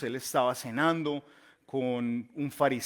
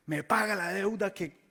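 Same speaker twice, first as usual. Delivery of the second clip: sudden high angry demand, grabbing by the neck; furious servant seizing his companion; acted angry demand; 7 semitones higher than usual. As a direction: bark it at the debtor, much higher.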